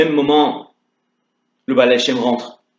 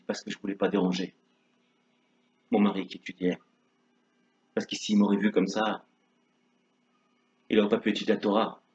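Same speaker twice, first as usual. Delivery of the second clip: soft tearful; 3 semitones lower, 8 dB quieter than usual. Acted. Chaya explique en pleurant.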